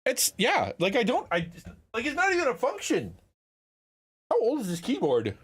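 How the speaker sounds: high-pitched, incredulous griping; baffled exasperation. Real, not acted. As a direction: voice rising in real disbelief. Baffled by the new keyboard.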